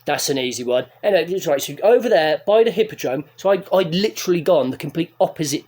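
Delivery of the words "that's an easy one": low, flat bored reply; deadpan bouncer. Retold or imitated. Imitating the bouncer's bored answer to a lost man. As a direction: drop it low and unimpressed. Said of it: "Just like".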